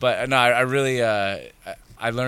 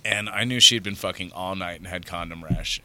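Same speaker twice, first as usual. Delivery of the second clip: ordinary delivery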